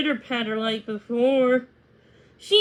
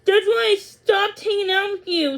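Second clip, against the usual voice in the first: ordinary delivery, nothing marked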